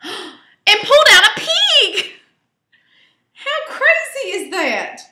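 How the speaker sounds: high, loud gasp of surprise; amazed reveal. A narrator's reading. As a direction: reveal it like a shock. Third reveal.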